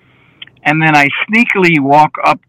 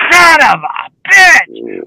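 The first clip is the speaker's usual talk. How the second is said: drawn out version; 10 semitones higher, slower than usual. Retold or imitated. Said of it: "says it in different ways".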